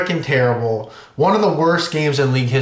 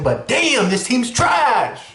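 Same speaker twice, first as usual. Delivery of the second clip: higher, slow fed-up venting; exasperated outburst; 8 semitones higher, much slower than usual. Real, not acted. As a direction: let the frustration spill out slowly. Frustrated fan venting about team.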